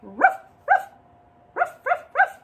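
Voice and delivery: gruff barking dog voice; loud rough dog barks. Acted. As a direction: bark it out like a big grumpy dog.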